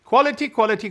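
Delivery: high, loud, insistent quality, quality; hammed-up fussy designer demanding quality; imitating Gianni's emphasis. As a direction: mimic his passionate insistence on quality. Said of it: "Johnny's thing was quality".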